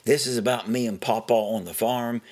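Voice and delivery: ordinary delivery, nothing marked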